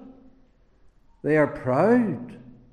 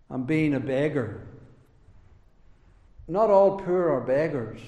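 usual speaking voice

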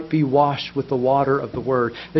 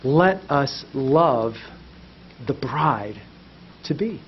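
tender; 3 semitones lower, 6 dB quieter than usual. Real, not acted. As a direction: let it fall gently. Tender closing of sermon.

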